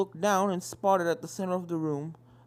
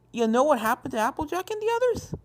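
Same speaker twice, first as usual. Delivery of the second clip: higher pleading question; a touch of fear; 9 semitones higher, 4 dB louder than usual. Acted. Filly asks the ghosts about her sister, a touch of fear. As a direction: ask bravely through fear. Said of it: "Despite her fear".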